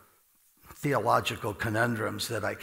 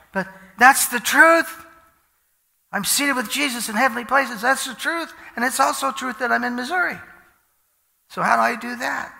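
high, loud, fervent preaching; preaching at full force; 12 semitones higher, 10 dB louder than usual. Acted. Preacher proclaiming to the congregation, fervently. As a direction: drive the truth home to the crowd.

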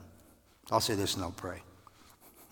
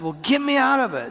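higher, louder begging; pleading demand; 11 dB louder than usual. Retold or imitated. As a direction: beg to be pulled out. Retold demand to God.

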